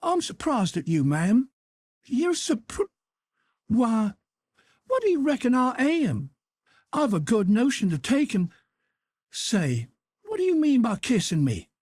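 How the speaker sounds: an aunt too shocked to finish; flustered angry auntie; outraged Aunt Sally. A narrator's reading.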